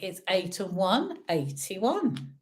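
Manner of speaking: sing-song bingo number calls; bingo caller. Acted. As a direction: call each number out like a bingo hall. Online bingo calling numbers.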